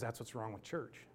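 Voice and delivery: soft reassurance. Retold or imitated. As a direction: reassure him quietly. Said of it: "She goes".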